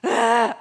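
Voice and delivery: loud fed-up ugh; sudden loud frustrated ugh; exasperated groan. Retold or imitated. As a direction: groan it out, overwhelmed. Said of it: "We're just like".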